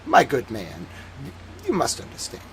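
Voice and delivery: mock Dickensian British toff; upper-class Dickensian British accent. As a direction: imitate a condescending upper-class British gentleman, quietly. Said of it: "it's kind of like Dickensian British, very upper class".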